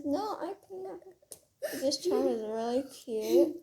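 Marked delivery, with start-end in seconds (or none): 0.0-1.0 higher, softer little alien voice; squeaky little space alien. Retold or imitated. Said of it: "how the alien says".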